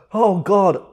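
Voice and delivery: loud, slow wail breaking into laughter; tearful laughing. Real, not acted. Eater in tears from spicy soup, tearful laughing. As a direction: cry out, overcome by the spice and laughing. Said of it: "I'm crying here".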